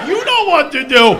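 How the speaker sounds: very loud, high demand; mock command. Player looking at the attendant expectantly, exaggerated loud command. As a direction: bellow it as an exaggerated order.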